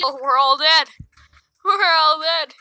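real loud outcry at a team wipe; loud exclamation. Real, not acted. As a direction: call out the defeat loudly. Whole team dies in the game.

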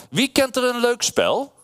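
high-pitched storybook mouse question; playful high animal voice. A narrator's reading. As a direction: ask it up high as a little mouse.

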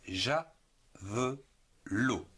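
"ja, ve, lo" as three separate beats; syllable by syllable. Shown as a demonstration. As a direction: pause between "ja", "ve" and "lo", each fully sounded. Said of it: "En trois syllabes". (« ja, ve, lo » prononcé syllabe par syllabe)

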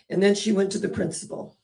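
ordinary talk

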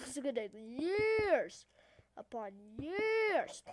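slow, dragged-out emphasis on YEARS; exaggerated drawl. Player moans about editing to viewers, exaggerated long YEARS.

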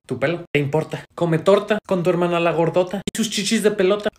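ordinary talk

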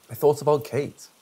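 ordinary delivery, nothing marked

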